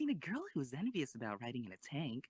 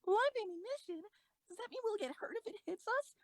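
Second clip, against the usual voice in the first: much higher, louder, frightened; alarmed; 4 dB louder than usual. Acted. Commander hearing live ammo rules, high frightened panic. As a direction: panic at the thought of real shells. Shocked by live rounds news.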